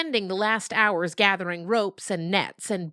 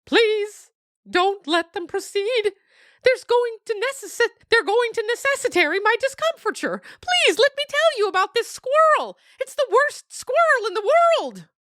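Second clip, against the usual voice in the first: frightened dog voice, much higher and louder; pleading captive dog; 7 dB louder than usual. Acted. Dog begging again.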